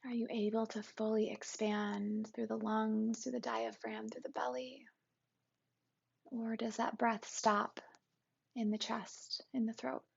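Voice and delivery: calm, even meditation guide with long pauses; guided meditation. Acted. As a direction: guide listeners gently into the body, unhurried and settled.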